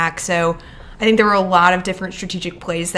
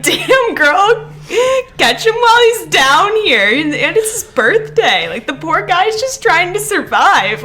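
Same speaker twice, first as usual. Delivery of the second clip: higher, louder, laughing sass; mock sassy defense; 8 semitones higher, 5 dB louder, slower than usual. Defending Lando to Catherine, hammed-up laughing sass. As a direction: tease her while sticking up for him. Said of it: "And I was like".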